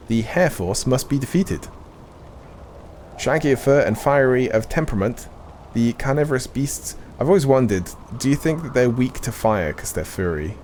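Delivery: measured, solemn NPC reading; game dialogue reading. Acted. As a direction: read it like a quest-giver's briefing. Reads game NPC dialogue aloud.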